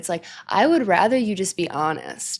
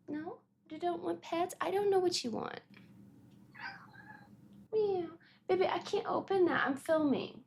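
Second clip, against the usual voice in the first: soft pet talk; 9 semitones higher, 12 dB quieter than usual. Real, not acted. Talking softly to her cat.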